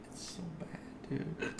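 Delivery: barely voiced, very soft; faint hushed lament; despairing whisper. Real, not acted. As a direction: whisper it, defeated. Hiccup despair.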